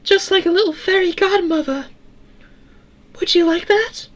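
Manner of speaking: very high tinny falsetto; Eleanor, metallic but kind. A narrator's reading. Eleanor thanking Sarah for saving her, kind tinny voice. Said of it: "Her voice, while metallic, also sounded kind".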